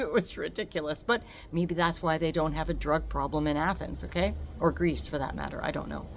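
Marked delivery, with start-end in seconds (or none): none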